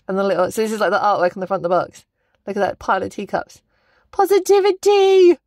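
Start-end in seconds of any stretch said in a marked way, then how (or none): 4.1-5.4 gushing read. Real, not acted. Reading washi design.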